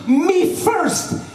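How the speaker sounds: put-on very high-pitched eager child squeal; eager little sister. Little sister rushing to board the boat, hammed-up eager squeal. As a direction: squeal it like an impatient little kid. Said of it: "so eager to get on this boat".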